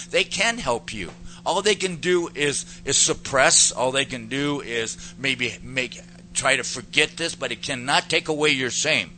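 loud, rising preacher's push; preaching at full force. Acted. Preacher refuting the world's offer of help, building loud.

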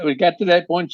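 ordinary talk

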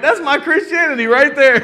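laughing admission. Retold or imitated. Preacher comparing herself to the silly sheep, laughing loudly. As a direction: own up through laughter. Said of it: "I'm like".

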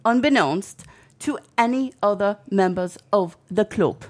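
put-on lilting English accent that slips toward Welsh; attempted English accent. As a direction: read a formal statement in a bad Yorkshire accent. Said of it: "your English accent is your Irish accent".

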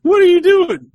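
high, loud demanding wife; suspicious wife. Acted. Wife walking in on husband, loud and accusing. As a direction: catch him in the act, demanding to know. Wife catches him mid-scene.

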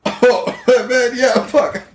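high-pitched, apologetic dude voice; hacker buddy. Acted. Hacker apologizes to Finram for forgetting him, in a high voice. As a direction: excuse yourself in a high, laid-back put-on voice.

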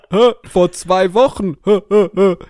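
amused fake laugh. Real, not acted. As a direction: cackle at your own joke. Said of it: "Generische Gags".

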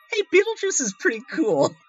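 high, quick put-on fan voice; easily charmed fan. Audience member still liking the gross villain, lightly mocked admiration. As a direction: pitch it way up, lightly mocking the fans.

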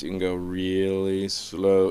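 drawn out slow, x0.6; slow demo. Shown as a demonstration. Comic showing how slow you can go, slow. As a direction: stretch the words out slowly. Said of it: "shift tempos like crazy".

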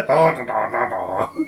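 slow, low, laughing sea creature voice; merman voice. Pretend. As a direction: drawl it like a silly merman. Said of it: "Can you say it in merman voice".

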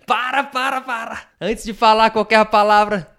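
high, loud TV host shouting; João Kleber. Pretend. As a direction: stop everything like a game-show host. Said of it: "Estilo João Kleber".

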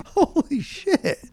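ordinary talk

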